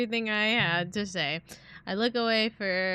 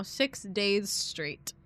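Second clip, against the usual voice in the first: ordinary talk, nothing marked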